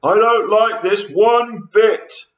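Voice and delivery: high, loud, drawn-out complaint; cried out. A narrator's reading. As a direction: wail it slowly as an upset Mister Twit. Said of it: "cried Mister Twit".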